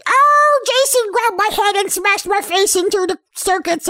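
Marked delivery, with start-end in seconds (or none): 0.1-0.6 high, loud hammed-up scream; mock dying scream. Electrocuted camper in his final moment, hammed-up loud scream. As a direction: scream as your face hits the circuits. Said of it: "to scream in your final moments".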